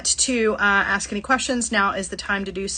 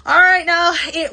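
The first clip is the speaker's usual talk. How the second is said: ordinary talk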